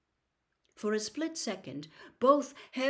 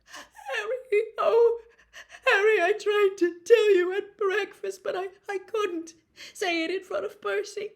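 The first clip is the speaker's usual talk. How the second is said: high, loud, crying voice; sobbing; 14 semitones higher, 10 dB louder than usual. A narrator's reading. Ginny sobs her confession to Harry. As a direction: blurt it out through tears. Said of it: "tears began to pour down her face".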